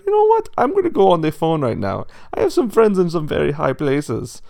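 high, loud, affronted pig; haughty rich pig. A narrator's reading. Pig boasts of friends to the wolf, haughty. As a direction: pull rank loudly in a high voice.

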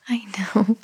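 hushed soothing whisper. Acted. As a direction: breathe it out softly to the baby. Comforting fussy baby.

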